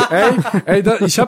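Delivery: bursting out laughing, high. Real, not acted. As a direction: laugh through the words. Laughing at Said's joke.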